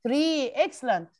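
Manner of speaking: ordinary delivery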